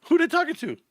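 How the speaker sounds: high-pitched, incredulous question; puzzled, voice jumping way up; mock bafflement. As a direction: act out confused disbelief. Acts out his baffled reaction.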